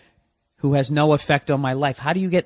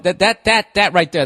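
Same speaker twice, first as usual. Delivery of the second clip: stuck on "that", repeated in a rapid, raised stutter; Porky Pig stammer; 3 semitones higher, 6 dB louder than usual. Real, not acted. Ranter arguing that faking it takes skill, stammering on "that". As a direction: stammer "that, that, that" louder before getting out "right there". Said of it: "I sound like fucking Porky Pig".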